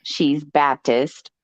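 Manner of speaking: ordinary talk, nothing marked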